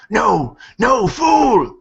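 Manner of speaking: high, loud monster shout; orc voice. Acted. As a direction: snarl it out like an angry orc. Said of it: "the orc just kinda goes".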